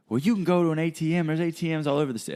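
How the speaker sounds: high, fast protesting voice; rapid high-pitched mock exasperation; imitated indignant hustler. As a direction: mimic a stranger arguing indignantly. Imitates the angry hustler arguing back.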